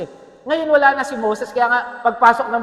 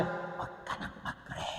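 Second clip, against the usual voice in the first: much softer sly whisper; the enemy whispering discouragement; tempting whisper; 18 dB quieter, slower than usual. Retold or imitated. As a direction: hiss it low like a tempter. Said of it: "yung kalaban, sinasabi".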